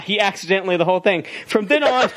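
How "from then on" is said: high-pitched email read, about to crack up; put-on high reading voice. Shown as a demonstration. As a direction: read it out high, barely holding back laughter. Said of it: "Yeah, email voice. Do it".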